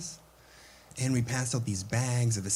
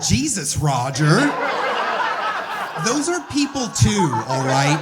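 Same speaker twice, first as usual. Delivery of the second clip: much higher and louder, appalled; shocked executive; 8 semitones higher, 8 dB louder, slower than usual. Retold or imitated. Executive rebukes designer Roger, loud high mock outrage. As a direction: scold him in mock outrage. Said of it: "he was like".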